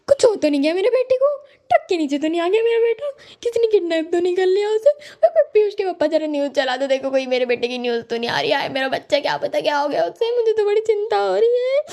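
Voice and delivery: high, fast frantic mother imitation; panicking mom. Comedian imitating her panicked mother, high and fast. Worried mom voiced in a standup bit.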